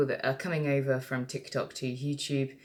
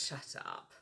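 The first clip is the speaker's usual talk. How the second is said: much softer, breathy disbelief; whispered aside; 20 dB quieter than usual. Real, not acted. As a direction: hiss it under your breath. Aside at the Empress card.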